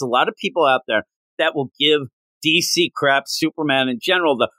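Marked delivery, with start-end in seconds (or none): none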